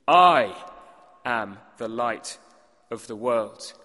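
"I" shouted out, then "am the light" slow and low; a loud lone "I" and a slow "am the light of the world"; stress on I. Shown as a demonstration. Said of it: "emphasis is on that first word".

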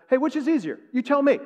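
high, loud challenge; acted confrontation. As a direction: throw the question right back at them. Jesus confronting the scribes, acted.

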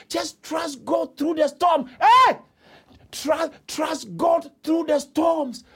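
high-pitched, fervent sermon cry; full-force preaching. Real, not acted. Preacher urging congregation to trust God through storms, impassioned shout.

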